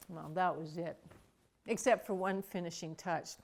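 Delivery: normal talking voice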